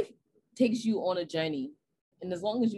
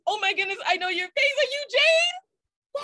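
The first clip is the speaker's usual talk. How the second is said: imitating gushing fan; 11 semitones higher, 6 dB louder than usual. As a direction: ham up an overexcited fan. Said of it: "And I'm like".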